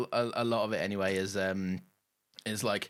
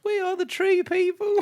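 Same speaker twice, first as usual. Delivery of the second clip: squeaky voice pitched way up; high alien voice; 5 dB louder than usual. Shown as a demonstration. Cohosts layering voices, comically high alien child voice. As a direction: go high and thin like a creepy child. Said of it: "I'll go high".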